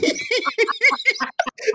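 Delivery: high shrieking laugh burst. Real, not acted. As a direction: let out a screaming cackle. Laughing scream while retelling.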